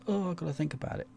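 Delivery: mock game voice. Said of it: "it kinda goes".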